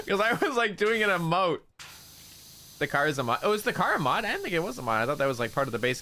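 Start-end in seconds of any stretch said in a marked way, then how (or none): 0.0-1.6 laughing. Real, not acted. Laughing while recounting embarrassment.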